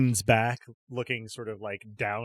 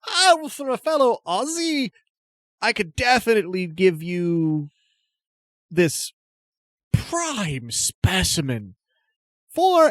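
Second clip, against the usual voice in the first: put-on bogus Australian accent; 8 semitones higher, 4 dB louder than usual. Stallholder claiming to be a fellow Aussie, fake Australian accent. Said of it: "nothing to do with an Australian accent".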